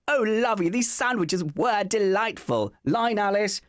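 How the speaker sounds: high, hammed-up luvvie voice; in-character actor. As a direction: stay grandly in character off stage. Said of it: "in character and then you never leave".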